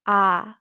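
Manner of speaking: ah, open Spanish a; Spanish short a. Shown as a demonstration. Teacher showing the Spanish vowel, modeling it. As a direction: sound out ah plainly for learners. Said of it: "consistently pronounced with a short vowel sound".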